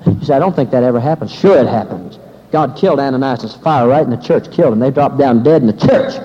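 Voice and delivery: loud, driving sermon delivery; fervent preaching. Acted. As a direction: preach it at full force to the congregation.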